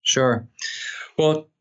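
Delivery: usual speaking voice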